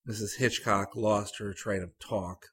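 plain reading voice

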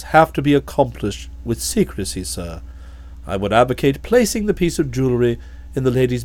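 low, calm, deferential butler voice; a low, imperturbable manservant; Jeeves the valet. Acted.

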